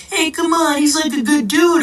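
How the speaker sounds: high-pitched mock coaxing; imitating fans. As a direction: mimic naive fans defending their idol. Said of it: "people look at these influencers and they say".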